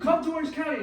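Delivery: high, loud mock summons; insistent. Friend pressing Art on the phone, insistent.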